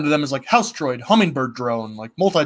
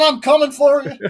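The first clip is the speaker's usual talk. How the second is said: mock villain voice; 10 semitones higher, 4 dB louder than usual. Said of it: "Oh, Unicron".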